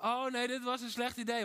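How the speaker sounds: high, loud sudden panic of doubt; oh no, what have I done; dismayed second thoughts. Retold or imitated. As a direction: blurt out the regret mid-leap. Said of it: "halverwege denken van".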